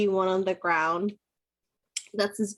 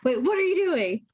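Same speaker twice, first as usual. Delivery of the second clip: higher, louder sudden alarm; alarmed goblin; 9 semitones higher, 3 dB louder than usual. Acted. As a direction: stop the stranger mid-spell. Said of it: "the other one says".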